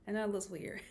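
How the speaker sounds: normal talking voice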